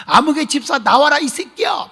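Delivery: loud high mock cursing call; imitated furious yell. Elder's wife calling the deacon out at his door, imitated angry yell. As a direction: bellow him out of the house in a mock rage. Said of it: "막 욕을 퍼본 거예요".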